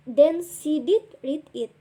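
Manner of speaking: "did" pushed out for emphasis; stress on DID. Shown as a demonstration. Teacher showing emphatic past tense, stress on "did". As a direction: punch the word "did". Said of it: "is did with emphasis".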